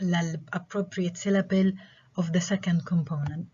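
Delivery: ordinary talk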